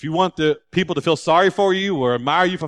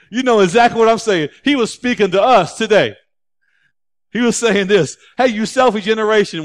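loud, high preaching to the room; fired-up pastor calling out his church; preaching at full force; 8 semitones higher, 11 dB louder than usual. Acted. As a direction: drive it home to the congregation.

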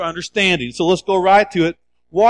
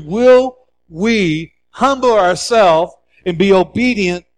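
ordinary talk

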